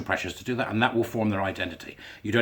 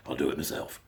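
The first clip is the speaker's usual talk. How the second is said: a much lower, quieter villain growl, half-joking; Thanos; 9 semitones lower, 9 dB quieter than usual. Retold or imitated. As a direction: rumble it out like the Marvel villain. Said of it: "you know, Thanos".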